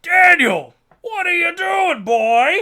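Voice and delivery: a father hollering at his wandering son, loud and high; calling from afar. Retold or imitated. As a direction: yell the boy's name as if from the distance. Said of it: "you hear off in the distance".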